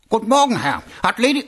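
high, loud, eager greeting; eager salute. Acted. Captain springing up to greet his commander, eager. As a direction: jump to attention with the voice.

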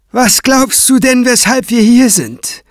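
high, loud derisive jab; sneering. A narrator's reading. Craven taunting Kranz about the visit, sneering. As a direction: throw the question out with scorn. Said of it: "fragte Craven höhnisch".

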